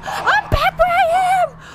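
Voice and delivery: panicking gamer. Real, not acted. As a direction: drag it out in despair. Repeats the same cry.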